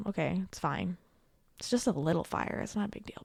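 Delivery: a quick conspiratorial whisper; whispered aside. Real, not acted. Host joking to viewers about the fire, whispered aside. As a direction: slip it in under your breath like a joke.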